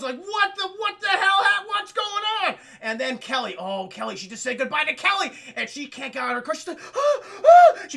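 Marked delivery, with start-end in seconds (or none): 0.2-2.6 much higher and louder panic; panicked what the hell. Retold or imitated. Characters shocked as cars explode, panicked. 6.9-7.8 high, loud terrified cries; panicked gasps. Retold or imitated. Woman trapped in her exploding car, terrified.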